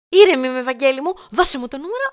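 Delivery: loud, high burst of impatience; exasperated insistence. Acted. Insists she is calm, threatens. (εκνευρισμένη απαίτηση με υψωμένη φωνή)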